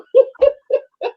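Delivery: a quick, high burst of laughter at the irony; short ironic laugh. Real, not acted. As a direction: laugh out loud at the absurdity of it.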